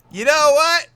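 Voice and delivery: high, loud group cheer; excited shout. Retold or imitated. Friends deciding to party after all, loud and high. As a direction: burst out like the gang rallying.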